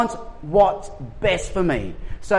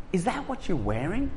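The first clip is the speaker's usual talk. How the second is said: loaded wife's question; 9 dB quieter than usual. Retold or imitated. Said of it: "That is a command disguised as a question".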